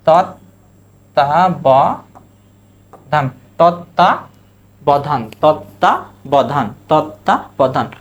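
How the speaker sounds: তত্ত্বাবধান stretched slowly, each syllable apart; slow pronunciation demo. Shown as a demonstration. Teacher modelling তত্ত্বাবধান for students, drawn out. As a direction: spell out তত্ত্বাবধান slowly aloud. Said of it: "এই যে".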